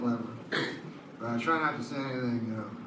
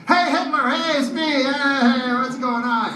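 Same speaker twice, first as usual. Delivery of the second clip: very high, loud dog greeting; overjoyed dog; 10 semitones higher, 6 dB louder than usual. Retold or imitated. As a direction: burst with joy as the master returns. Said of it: "Then Hitler comes in".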